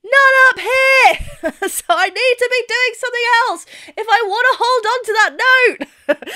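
squeezed high speech held on the note; high speaking demo. Shown as a demonstration. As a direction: speak way up high to show the strain. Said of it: "I'm speaking down here".

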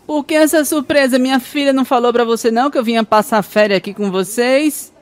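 high, put-on nagging mother-in-law; a mother-in-law scolding her son-in-law on arrival. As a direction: play the pushy mother-in-law arriving uninvited. Mother-in-law voiced in joke.

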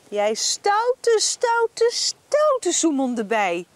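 mock stern telling-off, rising; playful scolding. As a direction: scold the naughty bee playfully. Scolding bee again.

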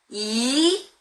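Mandarin second tone on 이. Shown as a demonstration. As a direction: sweep 이 slowly upward. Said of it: "한번 사성으로 해보겠습니다".

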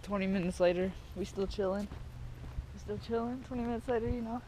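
quiet deadpan. Real, not acted. Joke narration, quiet.

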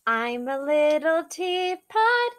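ordinary delivery

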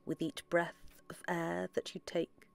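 soft, unhurried meditation voice with long pauses; guided meditation. Acted. Guide leading listeners into relaxed breathing, soft meditation voice. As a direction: guide them gently inward, softly, phrase by phrase.

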